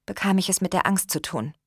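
usual speaking voice

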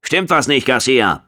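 high-pitched, barked question; barked challenge. A narrator's reading. Officer challenging soldier Garcia, barking. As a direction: bark it at a subordinate. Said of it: "blaffte Stannis".

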